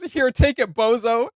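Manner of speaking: Slim giving up. Retold or imitated. Retelling the song, Slim surrenders the gun in a high mock whine. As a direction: hand it over with a whiny squeal. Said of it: "And he goes".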